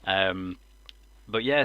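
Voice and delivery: ordinary delivery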